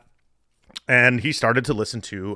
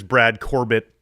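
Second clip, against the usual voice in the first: usual speaking voice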